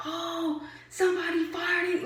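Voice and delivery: high, exaggerated "ew" reaction; mocking the other riders. Imitating riders on the bus, exaggerated high reaction. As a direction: squeal it like a scandalized kid.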